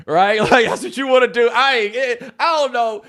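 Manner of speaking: high, loud laughing scoff; amused scoffing; laughing disbelief. Retold or imitated. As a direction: laugh it off as a lame idea. Mocking her movie idea.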